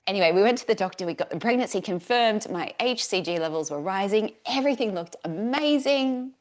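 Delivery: normal talking voice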